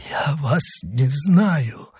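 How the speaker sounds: high, slow, faint reply; feeble bewilderment. Acted. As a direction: draw it out weakly, bewildered. Dying man answers avenger.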